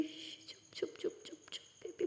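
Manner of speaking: put-on soft whispered shh to a crying baby; hushing whisper. Aliya hushing crying Maya in a whisper. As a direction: whisper to soothe her tears. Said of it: "Alia बोलती".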